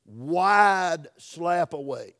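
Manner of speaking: slow, drawn out and loud; slow for emphasis. Shown as a demonstration. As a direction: stretch each word out for emphasis. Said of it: "that's for emphasis".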